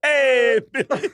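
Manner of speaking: mock douchey hey, loud and high; douchey greeting. Fan greeting [name] backstage, douchey. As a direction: imitate an overeager douche calling out. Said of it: "delivery that you give that makes me sound so douchey".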